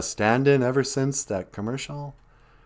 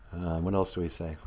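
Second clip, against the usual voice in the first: ordinary delivery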